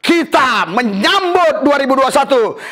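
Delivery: high, fast, full-throated praise call; preacher at full fervour, calling out haleluya; rousing preaching shout. Real, not acted. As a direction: lift the whole room into cheering. Preacher rallies congregation to cheer.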